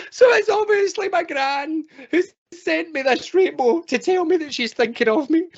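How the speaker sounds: high-pitched hammed-up sobbing; crying. Tearful walker insisting to the group they are fine, hammed-up crying. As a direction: wail it through mock tears. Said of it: "they're crying their eyes out".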